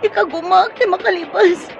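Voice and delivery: high, strained plea through pain; tearful pleading. Acted. Dying woman apologizes to Manang Mirna, tearful. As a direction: beg forgiveness while hurting.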